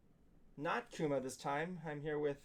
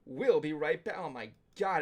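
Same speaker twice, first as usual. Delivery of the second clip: normal talking voice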